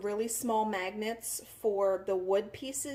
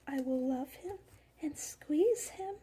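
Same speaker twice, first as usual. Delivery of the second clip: cooing; 4 semitones higher, 4 dB quieter, slower than usual. Real, not acted. Cooing over cute item.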